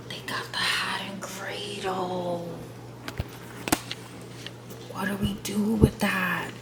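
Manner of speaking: breathy excited whisper. Real, not acted. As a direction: whisper it, too excited to say aloud. Excited secret aside over hat.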